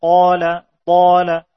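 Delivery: qala with a heavy, rounded aa like "aw"; loud, full dark vowel in each qala; thick alif. Shown as a demonstration. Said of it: "Ketika pendek di sini mungkin mudah".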